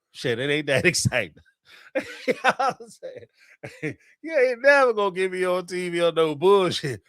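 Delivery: usual speaking voice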